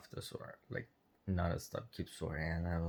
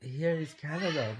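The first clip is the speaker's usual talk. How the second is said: mocking Karen; 8 semitones higher than usual. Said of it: "And she was like".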